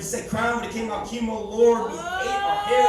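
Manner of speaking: loud, high preaching delivery; fervent spoken word sermon; preaching poem. Acted. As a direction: preach it to the room.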